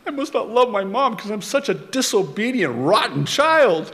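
put-on high, quick, self-pitying kid's voice; sulky child. Boy sulking after his mom's scolding, whiny and high. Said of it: "I'm like".

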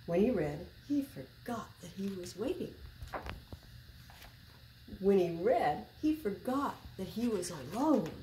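put-on reading to children. A narrator's reading. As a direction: read it like story time for little kids. Reading a picture book aloud.

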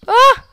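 short, high, loud yelp; startled scream. Real, not acted. Player startled in the middle of a game, startled scream. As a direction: cry out in sudden alarm. Startled cry while playing.

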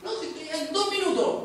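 pinched excuse voice; strained high voice. Shown as a demonstration. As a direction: squeeze it out nervously. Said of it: "Acá se produce la alteración".

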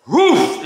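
loud mock dog bark; imitated dog woof. Imitating her pit bull's loud bark. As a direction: bark it out like a big pit bull. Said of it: "It's like".

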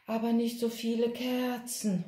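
owl's hoot. A narrator's reading. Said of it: "heulte die Eule".

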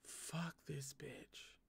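very soft, slow curse under the breath; muttered curse. Real, not acted. Fed-up reader cursing the villain under her breath. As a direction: drop the voice and grumble it slowly.

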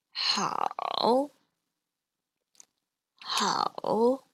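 好 drawn out, dipping then rising; slow demonstration of 好 with a falling-rising tone; slow third tone. Shown as a demonstration. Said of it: "these are both in the third tone".

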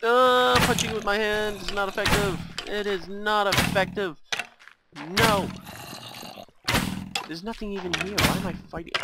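high, loud, panicked rant; flailing mid-fight, exasperated; frantic fighting. Real, not acted. Player punching zombies barehanded, loud frantic complaint.

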